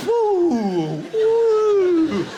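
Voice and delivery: high, loud rising whoop; imitating wife's whoop. Wife running across the landing, high loud whooping. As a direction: whoop like running through a time tunnel. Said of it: "Leaving a glitter trail behind her".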